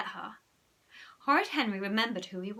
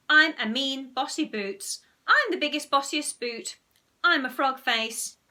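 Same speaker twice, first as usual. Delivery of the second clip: loud, higher mocking boast; cheeky boasting in mockery; mocking imitation; 5 semitones higher, 8 dB louder than usual. A narrator's reading. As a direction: taunt by pretending to be her. Ralph mocks Margaret as her.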